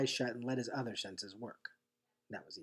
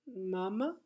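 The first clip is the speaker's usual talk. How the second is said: small high questioning call; a sleepy little girl asking for her mama; worried toddler; 7 semitones higher, 6 dB quieter than usual. A narrator's reading. Little sister, missing home, asks softly for mama.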